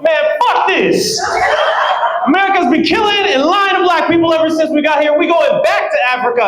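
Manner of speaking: high mock shout of outrage; hammed-up outraged yell, high.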